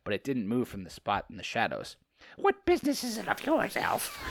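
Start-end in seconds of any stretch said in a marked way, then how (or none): 2.4-4.2 high squeaky creature voice, a touch hammed-up; lightly voiced creature. Reading the creature's lines to friends, squeaky. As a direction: play the little hissing creature, high. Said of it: "The creature hissed".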